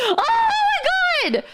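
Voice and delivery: a high, drawn-out laughing cry of disbelief; laughing shriek. Real, not acted. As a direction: shriek it slowly through laughter. Reacting to her own panic.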